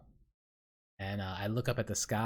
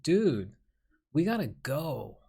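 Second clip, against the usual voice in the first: higher, louder pleading, drawn out on go; frustrated urging; 6 semitones higher, 5 dB louder, slower than usual. Retold or imitated. Dreamer urging stunned onlookers to go, frustrated. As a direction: urge a dawdling friend to leave, exasperated. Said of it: "me being really frustrated".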